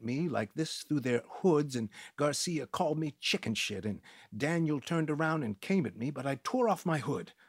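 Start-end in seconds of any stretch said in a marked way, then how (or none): none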